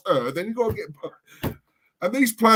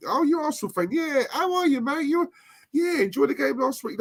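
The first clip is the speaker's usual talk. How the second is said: friendly player; 8 semitones higher than usual. Retold or imitated. Friendly player greeting a fan.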